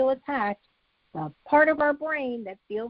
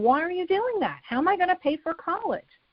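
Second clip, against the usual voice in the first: higher, upset son in the head; son's upset thoughts; 8 semitones higher than usual. Retold or imitated. Parent imagines her son's upset thoughts, higher. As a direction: voice the son's hurt disbelief, higher. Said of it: "I would be thinking".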